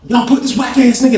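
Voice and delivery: high-pitched heated complaint; worked-up, high mock outrage; retold indignant protest. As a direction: act out protesting to the guards. Retold complaint to guards after fight.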